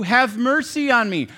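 high, loud desperate plea; a blind man begging Jesus loudly for mercy; crying out for mercy. Retold or imitated. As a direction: cry it out like a blind beggar on the roadside. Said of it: "someone is just crying out like th-, these two".

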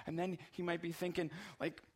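usual speaking voice

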